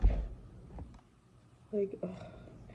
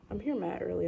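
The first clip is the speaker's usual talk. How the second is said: ordinary delivery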